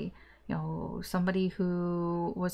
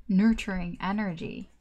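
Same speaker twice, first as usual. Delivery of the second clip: usual speaking voice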